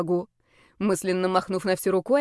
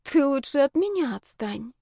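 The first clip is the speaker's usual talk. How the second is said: higher-pitched sleepy groan; 5 semitones higher than usual. A narrator's reading. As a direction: moan to be left alone. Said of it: "простонала в подушку".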